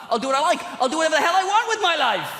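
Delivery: giddy fantasy rant building up; rising excited rant. Acted. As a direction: get carried away, pitch going up.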